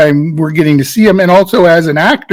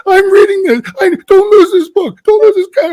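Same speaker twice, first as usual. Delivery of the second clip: much higher, frantic hammed-up panic; frenetic overacting; 13 semitones higher than usual. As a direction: overact a flustered prisoner, all nerves. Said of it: "the worst frenetic energy acting".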